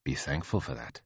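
low, soft, unruffled; quiet queenly rebuke. Acted. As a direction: rebuke without raising the voice.

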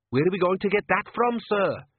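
a nervous junior voicing alarm; anxious waiter. Worried waiter questions manager.

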